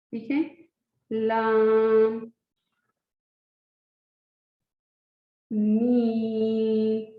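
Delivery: लाम मीम नून each stretched slow and clear; drilled letters. Shown as a demonstration. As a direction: teach the letters slowly one by one. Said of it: "लाम की तरफ". (हरूफ़ धीरे धीरे खींच कर पढ़ें)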